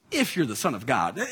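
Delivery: low, softly needling challenge; snide remark. Acted. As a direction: say it as a low-pitched dig. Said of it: "who like to make snide remarks".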